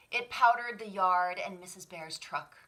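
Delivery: usual speaking voice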